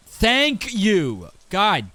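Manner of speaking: loud, high, drawn-out thanks; exasperated shout. Real, not acted. Exasperated cry at game finally complying.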